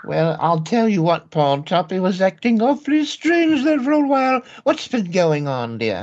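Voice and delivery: put-on high, fluttery elderly aunt voice; fussy old aunt. Aunt fussing over her nephews in a high old-lady voice.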